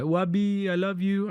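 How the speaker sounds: lightly put-on sweet caller; imitating late-night caller. Friend phoning the streamer in the middle of the night, imitated caller. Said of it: "he would call me in the middle of the night".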